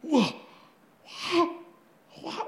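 mock-stunned, breathy little exclamations, spaced out; stunned reaction. Preacher acting out the house's shock at the healed cat, stunned. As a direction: imitate a roomful of amazed people. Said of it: "everybody at that house".

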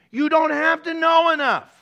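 preacher raising his voice to the congregation; emphatic preaching. Real, not acted. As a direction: drive it home to the church. Preacher driving point home.

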